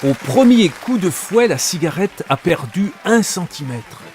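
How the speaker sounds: breathless wonder at the whip act; thrilled storytelling. Acted. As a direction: tell it like it's happening again in front of you. Narrator relives the whip act.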